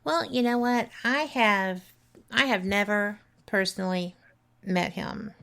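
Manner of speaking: normal talking voice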